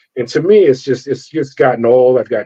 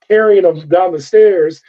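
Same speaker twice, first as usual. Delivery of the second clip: ordinary talk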